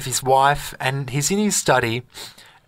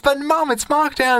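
put-on much higher whiny child's plea; little kid; 12 semitones higher than usual. Child in a toy shop begging his mum, high kid voice. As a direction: pitch way up and beg like a kid. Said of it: "and he goes".

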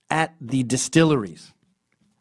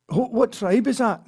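curious Scot; 9 semitones higher than usual. Retold or imitated. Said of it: "Yeah." / he continues".

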